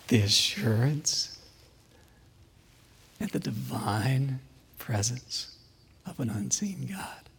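slow, fading to a whisper; hushed reverence. Real, not acted. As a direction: drop to an awed whisper. Reverent climax about divine presence.

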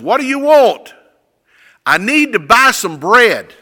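loud, high calling voice. Retold or imitated. Man and baker at midnight, calling out. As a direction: call through a door at night.

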